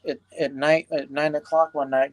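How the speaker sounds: ordinary delivery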